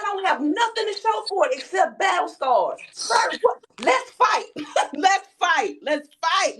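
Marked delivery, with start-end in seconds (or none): 2.9-6.6 mock fight challenge.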